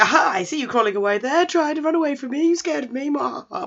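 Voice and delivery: high, hammed-up villain taunt; theatrical gloating with a mock evil laugh; gloating villain teacher. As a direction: taunt a runaway like a pantomime baddie. Gloating villain teacher catches runaway.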